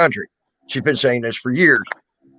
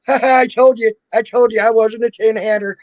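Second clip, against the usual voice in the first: mock told-you-so, higher and louder; gloating mom; 8 semitones higher, 4 dB louder than usual. Host voicing his mom's vindication, hammed-up gloating. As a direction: crow like a vindicated mom teasing. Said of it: "So now she's probably going".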